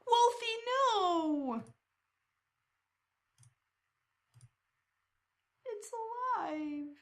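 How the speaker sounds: high, slow hammed-up wail over the wolf; hammed-up sobbing plea; mock anguish. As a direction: cry out in mock grief, dragging it. Player mourns living tortured wolf.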